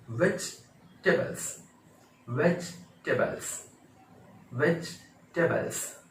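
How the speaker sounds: वेजिटेबल्स split slowly as vech... tebals; slow correct pronunciation. Shown as a demonstration. Teacher drilling learners on the word, slow syllable-split demonstration. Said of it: "ऐसे बोलेंगे".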